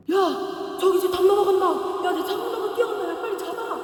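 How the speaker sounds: mock yell pointing out the runaway; tattletale shout. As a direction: raise the alarm like a snitch. Spy shouting she's escaping.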